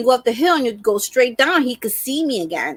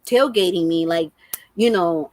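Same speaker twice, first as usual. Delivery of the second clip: ordinary talk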